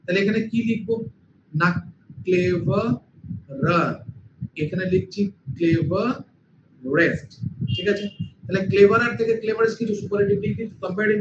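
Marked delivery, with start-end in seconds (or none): 2.3-3.9 ক্লেভারার very slowly, last রার set apart; syllable by syllable. Shown as a demonstration. Teacher building the comparative for students, ক্লেভারার slowly broken up. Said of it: "যেটাকে দুবারে উচ্চারণ করি". 5.6-7.3 ক্লেভারেস্ট very slowly, রেস্ট set apart; syllable by syllable. Shown as a demonstration. Teacher building the superlative for students, ক্লেভারেস্ট slowly broken up. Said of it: "যেটাকে দুবারে উচ্চারণ করি".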